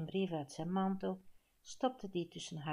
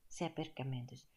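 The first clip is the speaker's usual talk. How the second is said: ordinary delivery, nothing marked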